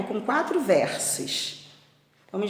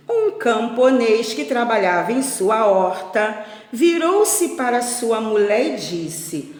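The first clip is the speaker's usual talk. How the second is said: expressive storyteller with drawn-out key words; lilting picture-book reading; children's story narration; 2 semitones higher, 9 dB louder, slower than usual. A narrator's reading. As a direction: read it aloud to a class of little ones. Reads story to pupils.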